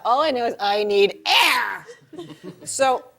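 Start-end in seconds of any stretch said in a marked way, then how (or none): 0.0-1.9 drawn-out, exaggerated demand; desperate for air. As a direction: stretch the last word out. Desperate naked woman in labor.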